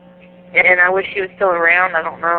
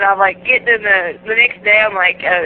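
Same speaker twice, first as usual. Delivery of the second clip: ordinary delivery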